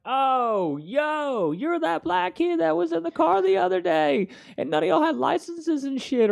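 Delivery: high, loud, excited cop voice; a cop who just spotted a familiar face; cop recognizing him. Retold or imitated. Cop recognizing Robbie from a traffic stop, high excited voice. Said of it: "the cop's like".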